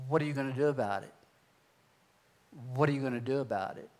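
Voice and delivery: low, soft, quick repeated question; echo in the head. Retold or imitated. God's question echoing in his head, low and soft. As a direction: murmur it like a nagging inner voice. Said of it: "that echo in my head".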